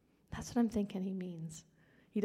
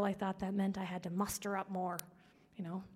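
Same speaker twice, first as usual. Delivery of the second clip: ordinary talk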